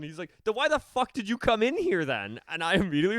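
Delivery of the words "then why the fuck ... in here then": high, loud retold yelling; angry yell. Stranger in the bathroom yelling at the narrator, loud and high. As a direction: bark it at him like a furious stranger. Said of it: "he just starts yelling".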